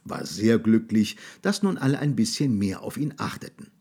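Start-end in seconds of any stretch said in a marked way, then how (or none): none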